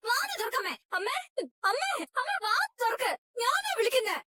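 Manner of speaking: urgent, pleading calls to mother; calling out. Acted. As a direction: call through the door, worried. Daughter calling through locked door.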